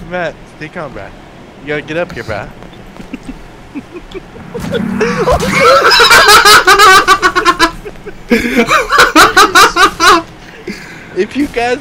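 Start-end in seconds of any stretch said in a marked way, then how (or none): 3.7-11.1 loud high howling laughter; long shrieking laugh. Real, not acted. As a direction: lose it laughing loudly. Laughing hard at friends.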